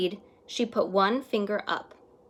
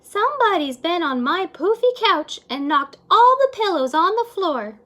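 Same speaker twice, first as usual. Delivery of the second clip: higher, louder motherly voice; a fussy mother librarian, pitched up; higher-voiced mama librarian; 9 semitones higher, 8 dB louder than usual. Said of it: "Then Mama Librarian said".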